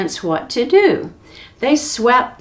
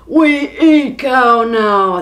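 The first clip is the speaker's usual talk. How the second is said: a loud, slow giant chant; giants shouting; 5 dB louder, slower than usual. Acted. Hungry giants forgetting their manners, slow loud shout. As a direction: stomp out each word, hungry. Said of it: "they shouted".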